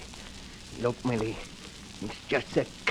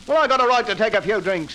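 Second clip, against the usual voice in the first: louder belligerent retort; drunken shout; 6 semitones higher, 4 dB louder than usual. Acted. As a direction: shout back defiantly. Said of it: "Stop shouting, Henry".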